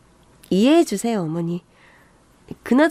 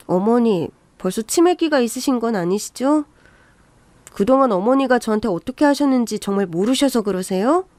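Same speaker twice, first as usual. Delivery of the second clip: usual speaking voice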